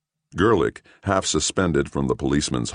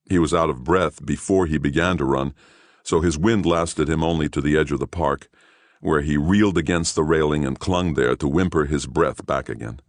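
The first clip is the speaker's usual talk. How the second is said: ordinary delivery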